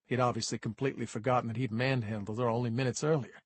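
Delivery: usual speaking voice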